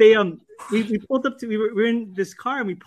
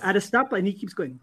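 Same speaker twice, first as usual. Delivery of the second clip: normal talking voice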